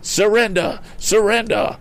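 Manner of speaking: high-pitched command to give up; crying out. A narrator's reading. Mister Damon threatens thieves with club, high cry. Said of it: "he cried".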